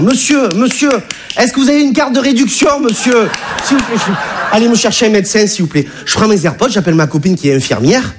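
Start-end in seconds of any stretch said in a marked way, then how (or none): 0.0-5.9 high, prim official voice; fussy ticket inspector. Retold or imitated. Train inspector fussing over a fainted passenger, in a high prim voice. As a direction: ask for the discount card as if nothing's wrong. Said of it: "en disant".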